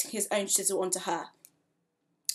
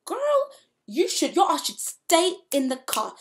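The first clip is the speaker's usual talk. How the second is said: loud, higher mock yell at the screen; 8 semitones higher, 7 dB louder than usual. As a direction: shout a warning at the girl. Yelling advice at TV character.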